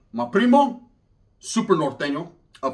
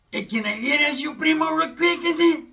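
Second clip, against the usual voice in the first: high, sing-song put-on voice; imitating the brother; 9 semitones higher than usual. Changa's brother asking the narrator a favor, high mocking voice. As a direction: mimic his cagey ask, pitched up. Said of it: "exactly what the fucker asked me".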